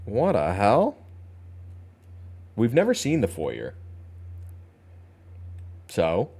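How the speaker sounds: ordinary talk, nothing marked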